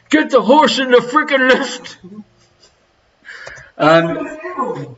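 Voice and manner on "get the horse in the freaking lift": high mimicked Dublin accent; playful Dublin accent impression; mock Dublin accent. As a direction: echo a comic Dublin accent, pitched up. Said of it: "let Pete hear your Dublin accent".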